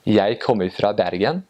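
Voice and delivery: light, sing-song Oslo accent on Jeg kommer fra Bergen; Eastern Norwegian dialect. Shown as a demonstration. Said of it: "so in the Eastern dialect".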